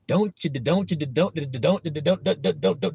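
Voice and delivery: rapid, stuttered repeats like a stuck record; skipping record. Acted. Mocking the nagging doubter, skipping record. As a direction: loop the words, catching on each one. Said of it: "that record need to get broke over my knee and threw in the trash 'cause it's skipping".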